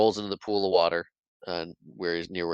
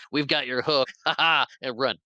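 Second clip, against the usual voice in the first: mock taunt; 6 semitones higher, 3 dB louder than usual. Lost Boys taunting Hook.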